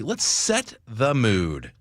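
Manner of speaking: slow and suggestive; slow, drawn-out. Shown as a demonstration. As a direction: draw the words out slowly.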